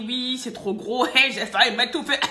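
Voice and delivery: mock customer complaint. Vlogger imitating customers who struggle with big capsules, mock complaining. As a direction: mimic an exaggerated grumble, building in volume.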